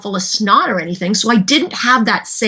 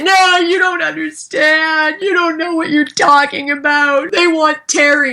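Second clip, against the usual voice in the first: higher, slower exasperated protest; exasperated reply to husband, pitched up; frustrated shout; 6 semitones higher than usual. Retold or imitated. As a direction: snap back at your husband. Arguing with husband, retold.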